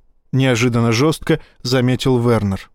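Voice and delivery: ordinary delivery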